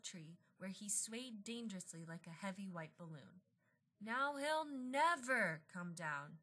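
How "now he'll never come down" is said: high, slow, soft wail; lamenting. Acted. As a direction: moan it slowly in mild despair. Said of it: "Mister Sims lamented".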